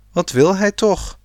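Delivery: high, worried question. A narrator's reading. Sanne frets about the shouting farmer, worried and high. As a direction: ask it high with rising worry. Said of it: "vroeg Sanne angstig".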